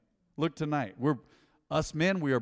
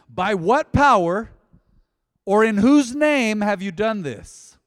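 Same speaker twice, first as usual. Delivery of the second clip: loud, slow, booming demand; slow shouted challenge from the council; council's demand; 6 semitones higher, 10 dB louder, slower than usual. Acted. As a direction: thunder the question at them. Preacher voicing council's demand.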